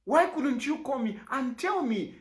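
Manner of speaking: ordinary talk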